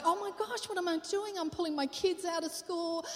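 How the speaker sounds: high, frantic panic voice; panicked. Retold or imitated. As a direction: voice climbing in alarm. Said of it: "I was terrified".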